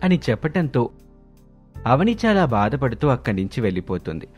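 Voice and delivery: plain reading voice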